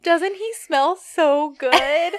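high, slow, dreamy drawl; mock swooning. [name] to the girls after he brushes past, mock swooning. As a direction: gush like someone smitten, drawn out and high.

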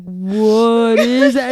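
very drawn-out slow-motion question. Retold or imitated. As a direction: stretch every word like slowed-down film. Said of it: "said it in actual slow motion".

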